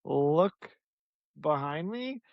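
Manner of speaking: drawn out slowly; slow, deliberate. Retold or imitated. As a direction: say it slowly for suspense.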